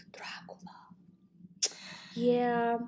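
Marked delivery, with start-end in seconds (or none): 0.1-0.6 breathy spooky whisper; whispered Dracula. Pretend. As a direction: whisper the name like a vampire.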